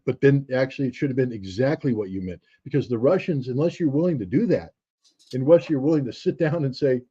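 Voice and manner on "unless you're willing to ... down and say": ordinary talk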